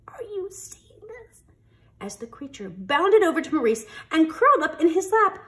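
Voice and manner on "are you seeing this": amazed whisper. Acted. Beasts watch in amazement.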